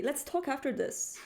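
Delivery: ordinary delivery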